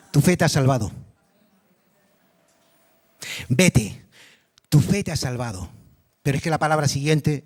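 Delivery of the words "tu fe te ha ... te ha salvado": lower, gentle blessing. Acted. Jesus tells the forgiven woman she is saved, low and tender.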